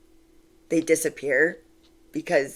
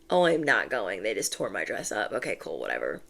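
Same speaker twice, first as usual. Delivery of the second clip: mock dejected Cinderella; 9 semitones lower, 5 dB quieter than usual. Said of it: "even Cinderella was like".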